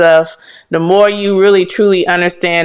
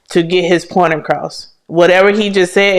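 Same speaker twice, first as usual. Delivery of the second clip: ordinary delivery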